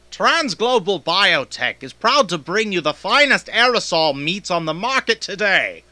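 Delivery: smooth announcer read. Acted. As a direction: sell the product, polished. Voice actor reads ad copy.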